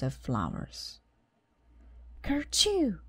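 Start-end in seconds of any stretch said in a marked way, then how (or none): 2.2-3.0 high sneeze. A narrator's reading. Flowers knocked, sneeze.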